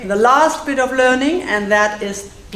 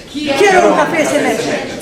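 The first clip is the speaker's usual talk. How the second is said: high, louder, rattled off in one breath; said fast; 6 semitones higher, 4 dB louder than usual. Shown as a demonstration. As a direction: race through it for the class to copy. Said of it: "let's say it fast".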